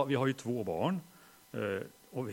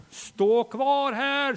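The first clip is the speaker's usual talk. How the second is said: high, loud, slow shouted order; panicked shout; 12 semitones higher, 11 dB louder, much slower than usual. Retold or imitated. Father shouts to wife Lillemor, high slow panicked yell. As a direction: yell it across the crowd to your wife. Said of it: "skriker jag".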